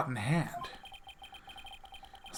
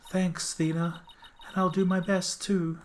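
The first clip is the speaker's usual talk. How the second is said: timid schoolgirl voice; 6 semitones higher than usual. Acted. Junie voiced in game.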